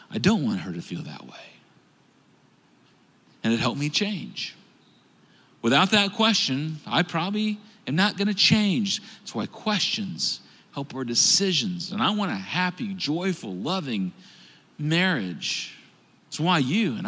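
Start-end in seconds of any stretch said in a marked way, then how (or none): 0.1-1.3 quiet admission. Real, not acted. Quiet confession after question. 11.9-15.7 sincere wish. Real, not acted.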